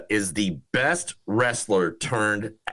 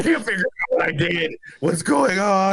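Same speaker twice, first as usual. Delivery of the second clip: mock panic; 9 semitones higher than usual. Co-host teases friend's scary memory lapse, mock panic. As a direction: play up a confused freak-out, pitched way up. Mocking co-host's memory scare.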